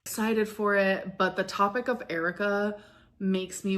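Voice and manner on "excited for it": ordinary talk, nothing marked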